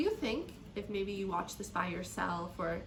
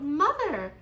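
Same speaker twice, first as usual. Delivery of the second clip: put-on high, loud child's call; shout; 6 semitones higher, 12 dB louder than usual. A narrator's reading. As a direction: shout out for mother. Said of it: "the little chicken shout".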